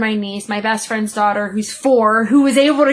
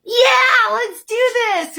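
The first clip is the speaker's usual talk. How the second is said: high, eager little cheer; kids' excited cheer; 11 semitones higher, slower than usual. Retold or imitated. Imitating her young daughters keen to ride, high excited cheer. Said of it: "they're like".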